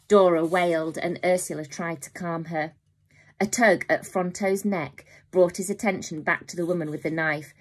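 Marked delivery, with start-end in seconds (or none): none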